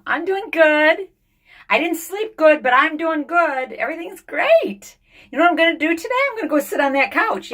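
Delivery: high, loud, sing-song mock cheer; pretending everything is great for the viewers; fake cheerful vlogger. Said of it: "be completely pretend the whole day".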